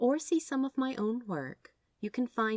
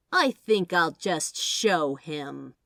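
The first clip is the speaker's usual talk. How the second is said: menacing angel; 3 semitones lower, 9 dB louder, much slower than usual. Acted. Angel about to snap fingers.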